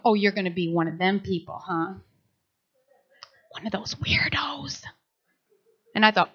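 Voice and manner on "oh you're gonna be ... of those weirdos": low, soft taunt sinking to a whisper; sneering inner voice. Acted. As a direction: taunt quietly, like a doubt in the head. Inner doubting voice mocking her.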